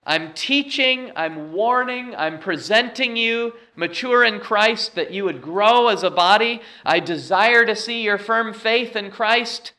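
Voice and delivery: high, insistent list building in emphasis; voicing Paul's appeal. Preacher speaks as Paul to the church, insistent. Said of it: "Paul is saying here".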